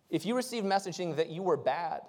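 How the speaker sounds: normal talking voice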